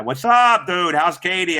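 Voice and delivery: loud, high, drawn-out hail; called-out greeting. Real, not acted. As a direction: holler hello to a buddy. Greets someone by calling out.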